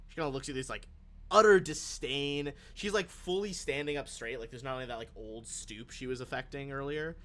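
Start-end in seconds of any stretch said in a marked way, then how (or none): none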